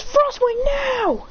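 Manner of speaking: high, drawn-out battle shout; attack call. Acted. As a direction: shout the command, holding the last word. Hero calls out an attack.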